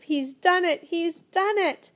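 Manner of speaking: triumphant cry. A narrator's reading. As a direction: shout it out in triumph. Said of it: "Ben cried in triumph".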